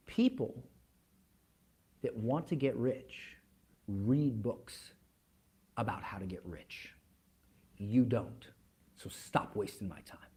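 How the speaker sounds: low, hushed rebuke with heavy pauses; quietly stern. Retold or imitated. Billionaire boss dismissing a naive salesman, quietly stern. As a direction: sink almost to a whisper, dead serious.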